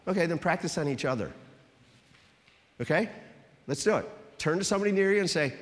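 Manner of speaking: ordinary talk, nothing marked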